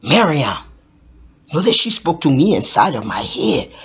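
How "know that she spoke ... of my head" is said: usual speaking voice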